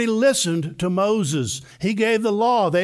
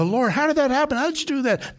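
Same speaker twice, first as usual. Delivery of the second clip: eager disciple; 8 semitones higher than usual. Acted. Disciple questioning Jesus.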